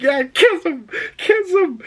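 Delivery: high-pitched giddy egging-on; excited cheering. Retold or imitated. Viewer urging on the film character, high excited retelling. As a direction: squeal it like an over-invested fan.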